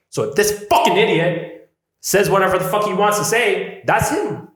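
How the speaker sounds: high, loud, heated self-pep talk; fired-up rant. Real, not acted. Pumping himself up against a heckler, loud and heated. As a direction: rant yourself into courage. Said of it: "self-talk getting fired up".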